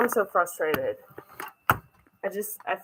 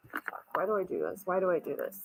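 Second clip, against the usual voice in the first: quiet frustrated mutter; 11 dB quieter than usual. Real, not acted. Frustrated self-reproach, muttered aside.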